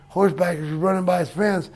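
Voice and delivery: ordinary talk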